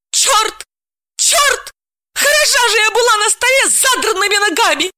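very high, loud stamping yell; shouting. A narrator's reading. Dalila stamping her feet, screaming in shame. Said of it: "закричала".

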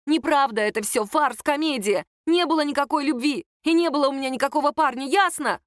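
exasperated, agitated declaration; heated confession. A narrator's reading. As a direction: blurt out the bitter truth. Sister bursts out confessing to family.

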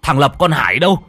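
loud, rising frightened shouts to the family; panicked calling. A narrator's reading. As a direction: yell for everyone in panic. Ông gọi cả nhà hoảng hốt. (ông hoảng hốt gọi to cả nhà)

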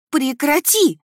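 high, loud, desperate cry; pleading scream. A narrator's reading. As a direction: scream it as a plea. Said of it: "закричала я с мольбой в голосе". (крик с мольбой)

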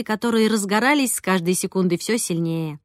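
plain reading voice

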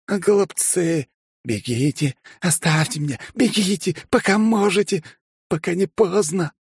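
high panicked warning cries; frantic warning. A narrator's reading. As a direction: warn them to run now. (панический крик)

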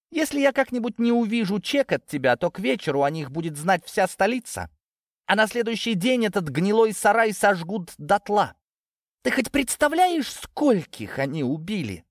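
high-pitched menacing outburst; threatening. A narrator's reading. Natasha blackmailing Ginzburg for money, threatening. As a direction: drive the threat home in a high voice.

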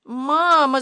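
high, loud call for mother; boy's cry. A narrator's reading. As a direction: cry out as a storybook boy. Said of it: "закричал Ваня".

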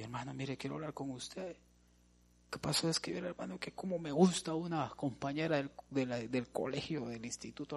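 softly afflicted youth. Retold or imitated. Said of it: "Lo vi afligido".

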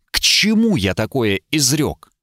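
plain reading voice

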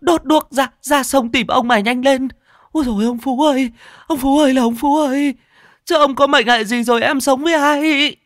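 very high, loud keening cries; piercing, frantic lamentation; hysterical wailing wife. Acted. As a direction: wail for help and lament the husband. Wife wails on hearing husband drowned.

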